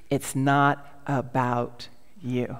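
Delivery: low, very slow and deliberate; weighty quotation. Real, not acted. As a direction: let each word land separately. Quotes book's first sentence weightily.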